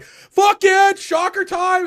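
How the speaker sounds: very high, loud excited shout; gleeful yell. Retold or imitated. Fan acting out hero charging in, gleeful high shout. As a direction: explode with childish glee. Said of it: "Tojima's just like".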